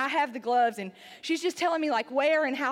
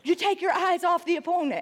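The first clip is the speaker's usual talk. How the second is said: high, loud mock scolding from a coach; retold scream, pitched well up; imitated screaming; 5 semitones higher, 3 dB louder than usual. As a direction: holler a warning like an outraged instructor. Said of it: "And she screams at me".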